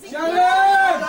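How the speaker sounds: high, loud call across the room; shouting for Sharon. Real, not acted. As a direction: call her name over the party. Calling out for Sharon in the crowd.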